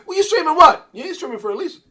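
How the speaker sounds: high, very loud challenge easing off slightly; mock indignant shout. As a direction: play an outraged objection at full volume. Acts out challenge about streaming.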